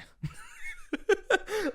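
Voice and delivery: bursting high-pitched laugh. Real, not acted. Telling a friend the movie scene, high squealing laugh. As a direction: let a loud squealing laugh out.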